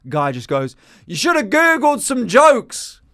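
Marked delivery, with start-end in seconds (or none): 1.1-3.0 high, slow mocking heckler voice; heckler jeer. Comic retelling a heckler's jab at him. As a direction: draw out the jeer like a smug heckler. Heckler line retold in a story.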